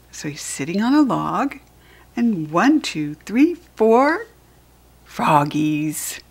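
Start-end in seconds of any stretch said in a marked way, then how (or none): none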